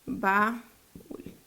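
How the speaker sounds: "Baúl" split as "ba" and "úl", long gap; syllable by syllable. Shown as a demonstration. Teacher divides another example, "Baúl" syllable by syllable. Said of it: "la dividimos en sílabas".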